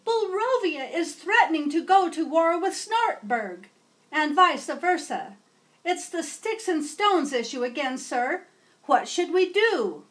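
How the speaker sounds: Madam Secretary reporting war threat on the phone; anxious secretary. Acted. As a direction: rush the bad news like a fretful aide.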